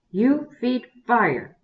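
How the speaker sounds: gruff bear chief command, slow and loud; growled command. A narrator's reading. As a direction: growl the order slowly like a bossy bear chief. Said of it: "he growled".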